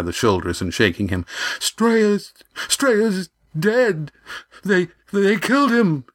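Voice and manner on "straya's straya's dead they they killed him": high, broken, panicked voice; stammering shock. Acted. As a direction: stammer out the terrible news. Yan reports death in shock.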